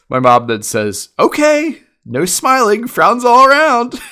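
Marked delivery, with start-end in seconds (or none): 1.2-4.0 high, loud imitation of mom; exaggerated compliance, voice pitched way up; mock stern announcement. Mom answers the scolding, loud mock sternness.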